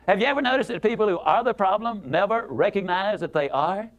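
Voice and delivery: ordinary delivery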